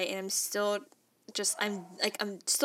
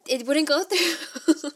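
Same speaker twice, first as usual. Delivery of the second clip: higher and slow, laughing through the words; laughing in disbelief; 7 semitones higher, 7 dB louder, slower than usual. Retold or imitated. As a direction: laugh at your own bad luck. Failed wire transfer, laughing.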